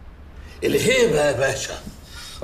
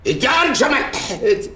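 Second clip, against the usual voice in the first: indignant surprise; 8 semitones higher than usual. Acted. Father protests at accusation.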